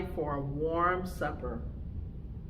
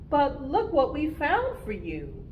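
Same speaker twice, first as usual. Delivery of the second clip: little bear cub; 8 semitones higher than usual. Acted. Cubs show Mama the new shell.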